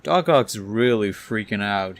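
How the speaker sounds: plain reading voice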